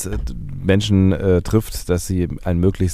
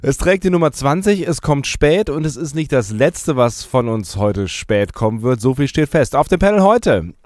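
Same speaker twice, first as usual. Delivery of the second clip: festive announcer; 8 semitones higher than usual. Acted. Opening announcement of episode.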